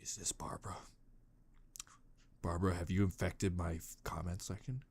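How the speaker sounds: whispered, low suspicion; mock suspicious whisper. Suspecting Barbara of invading his comments, whispered. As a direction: hiss the question like a conspiracy.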